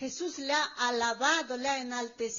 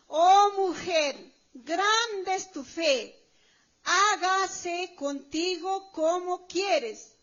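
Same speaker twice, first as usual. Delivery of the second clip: slow, loud, tearful proclamation; weeping preacher voicing Jesus, slow and loud; with mercy; 4 semitones higher, 6 dB louder, much slower than usual. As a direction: pour mercy into every drawn-out word. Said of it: "está hablando con misericordia".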